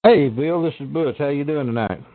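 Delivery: deep Southern accent. Real, not acted. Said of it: "You have a deep Southern accent".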